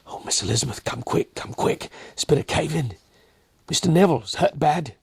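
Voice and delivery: high, breathless alarm from the doorway; panicked maid. Acted. Peggy bursting in to fetch Elizabeth, high frantic alarm. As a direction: gasp it out in a rush of panic. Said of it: "Peggy rushed in".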